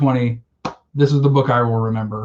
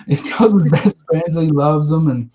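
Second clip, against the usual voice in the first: choked-up laugh while retelling the ending, a touch higher; laughing through tears; 4 semitones higher, 4 dB louder than usual. Real, not acted. Fighting tears while telling a friend the book's ending, laughing. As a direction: laugh to hold back the tears. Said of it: "I'm trying not to cry now".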